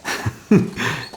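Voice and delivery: a soft, breathless chuckle; soft laugh. Acted. Alonso laughs softly at his cousin's fear. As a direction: laugh off her fear under your breath. Cousin teases frightened Beatriz.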